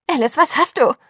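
frightened friend calling out. A narrator's reading. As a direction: cry out to her in alarm. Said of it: "rief ich".

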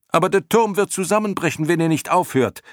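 high, urgent cry; warning shout. A narrator's reading. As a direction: shout a desperate warning up to them. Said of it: "schrie Bastian". (warnend schreien, mit hoher Stimme)

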